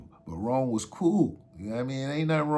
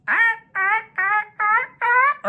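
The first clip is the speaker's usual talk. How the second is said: high, loud repeated "ah" bursts; heightened-senses noise; 10 dB louder than usual. Retold or imitated. Storyteller imitating how extra everything felt high, sharp yelps. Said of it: "everything is, like, extra".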